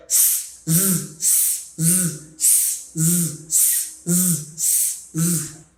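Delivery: "S" hissed, then "z" buzzed; hiss-buzz drill on "s" and "z"; alternating S and Z sounds. Shown as a demonstration. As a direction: switch from voiceless "s" to voiced "z". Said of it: "the S sound followed by the Z sound".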